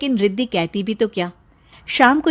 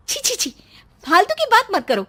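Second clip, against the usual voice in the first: much higher disgusted tut; 10 semitones higher, faster than usual. Acted. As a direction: recoil in disgust. Disgust at nausea talk. (घिन से छी छी)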